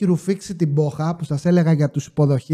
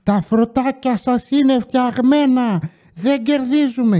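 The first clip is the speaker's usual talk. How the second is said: put-on higher, slow drunken complaint; mock drunk shout, pitched up and dragged out; drunk gamblers yelling; 6 semitones higher, slower than usual. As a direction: play the sloshed players griping at the machines. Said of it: "αρχίζουν να φωνάζουν".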